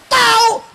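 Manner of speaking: "Tahu" high and loud, Betawi style; Betawi intonation. Acted. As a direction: throw out "Tahu" as a loud Betawi exclamation meaning no idea. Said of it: "tuh intonasinya beda". ("Tahu" dengan intonasi Betawi, tinggi dan kencang)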